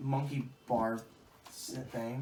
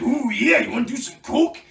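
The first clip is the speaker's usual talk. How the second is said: high, loud wrestler impression; Macho Man; 8 semitones higher, 10 dB louder than usual. Friend offering coke, wrestler impression. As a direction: imitate a hyped-up wrestler. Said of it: "uh, Macho Man".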